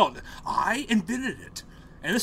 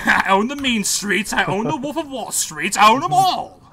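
higher and louder with each claim; mock boasting oilman; 4 semitones higher, 5 dB louder than usual. Joker voicing the oilman claiming every gang, building up. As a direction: build up to a triumphant brag.

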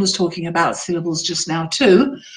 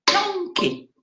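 "donkey" with a high, long DON and a small key; stress on first syllable; 10 semitones higher, 5 dB quieter than usual. Shown as a demonstration. Teacher naming the tapped animal, "donkey" stressed on DON. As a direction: stretch DON high in "donkey", drop on the ending. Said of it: "And a donkey would be".

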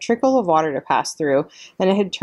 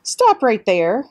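louder, higher, slow command; stern halt; 6 semitones higher, 4 dB louder, slower than usual. A narrator's reading. Mister Beaver ordering the robot to stop, slow loud command. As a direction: bark a slow firm stop. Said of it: "which meant".

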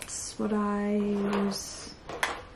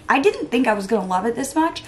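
usual speaking voice

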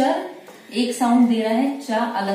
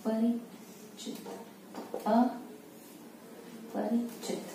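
अपरिचित said slowly and much softer; slow quiet dictation; 2 semitones higher, 12 dB quieter, slower than usual. Shown as a demonstration. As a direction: murmur अपरिचित slowly while writing. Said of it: "इसके बाद शब्द है अपरिचित". (अपरिचित को धीमे और धीरे बोलना)

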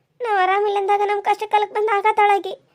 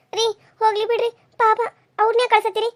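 usual speaking voice

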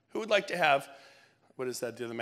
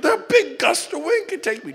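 mock daredevil; 11 semitones higher, 11 dB louder than usual. Mock kite surfer bravado.

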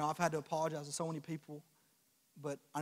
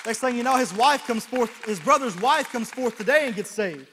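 louder, higher and slower with each line; rising sermon climax, loud and slow; building preaching climax; 7 semitones higher, 12 dB louder than usual. Acted. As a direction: build the praise to a shout.

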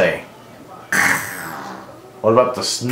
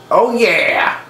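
singsong oh yeah; 7 semitones higher than usual. Shown as a demonstration. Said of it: "Can you say".

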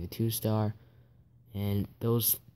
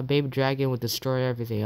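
normal talking voice

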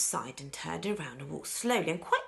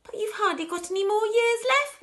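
high, loud protesting wail; 7 dB louder than usual. A narrator's reading. Grandpa Joe protesting the lost years, wailing. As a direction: wail in acted dismay. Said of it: "wailed Grandpa Joe".

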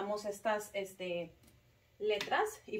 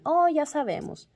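high, loud burst of recognition; children's knowing exclamation; 7 semitones higher, 8 dB louder than usual. Retold or imitated. Children telling teacher they already know, knowing exclamation. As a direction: exclaim it higher and louder, like kids catching on.

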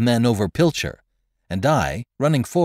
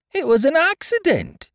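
very high, desperate protest; high-pitched frantic excuse; crying out; 3 dB louder than usual. Acted. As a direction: plead it in a shrill cry. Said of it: "cried out the man".

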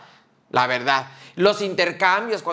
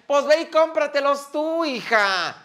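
mucho más aguda y lenta, con fastidio; exasperada; 7 semitones higher, slower than usual. Real, not acted. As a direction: sube la voz muy alto, harta del tema. Se queja del intercambio. (muy aguda y lenta, harta de los regalos pedidos)

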